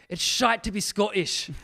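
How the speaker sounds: loud, high-pitched mock Scottish cry; shouted Scottish accent. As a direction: imitate an actor shouting in Scottish. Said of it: "he'd always shout".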